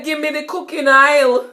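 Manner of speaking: lively Jamaican patois request. Shown as a demonstration. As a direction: Jamaican patois, oil said aisle. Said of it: "me say".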